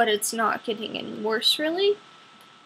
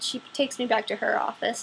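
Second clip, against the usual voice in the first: normal talking voice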